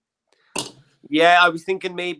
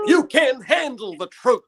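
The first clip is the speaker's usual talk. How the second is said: movie quote delivery; 8 semitones higher, slower than usual. Retold or imitated. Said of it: "movie line riff".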